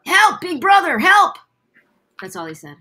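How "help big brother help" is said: put-on high, loud boy calling for help; Mokuba voice. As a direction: cry out like a scared kid. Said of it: "Mokuba in Yu-Gi-Oh".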